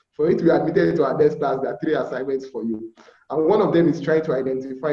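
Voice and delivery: ordinary talk, nothing marked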